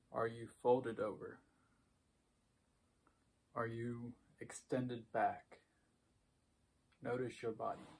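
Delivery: soft, unhurried meditation voice with long pauses; hushed, even cues for noticing breath and body; guided meditation. Acted. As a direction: guide a quiet body scan, leaving space after each phrase.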